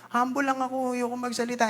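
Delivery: high, soft mock-humble voice. Preacher mimicking a falsely humble churchgoer, high and soft. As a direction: mimic a falsely modest member, lightly.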